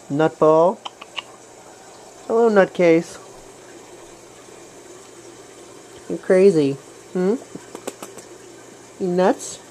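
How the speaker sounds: loud playful cat talk; playful teasing. Real, not acted. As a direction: tease the crazy cat.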